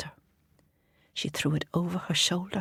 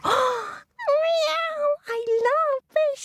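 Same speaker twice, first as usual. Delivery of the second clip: pussycat voice. Acted. Pussycat hearing of fish, high cat voice. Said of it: "said the pussycat".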